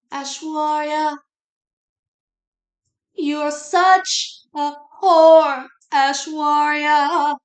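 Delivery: high, loud, slow theatrical wail; mock melodramatic lament. Mocking the story's writer addressing Aishwarya, hammed-up loud lament. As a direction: ham up a tortured writer crying out.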